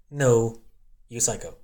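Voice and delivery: teasing yell of disbelief; loud mock outburst. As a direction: shout it like playful outrage. Retelling own exclamation.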